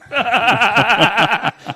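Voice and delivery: loud, high burst of laughter; laughing. Real, not acted. Podcast host cracks up at a drug joke, loud laughing. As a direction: cackle hard at the joke.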